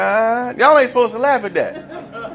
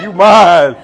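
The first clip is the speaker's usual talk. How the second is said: menacing inmate; 3 semitones lower, 8 dB louder than usual. Retold or imitated. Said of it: "somebody gonna be like".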